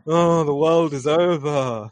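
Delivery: slow, put-on gloomy moan; lightly hammed-up doom, drawn out; mock despair. Teasing a friend role-playing at the desk, mock despair. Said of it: "You're sitting there on your, on your desk like".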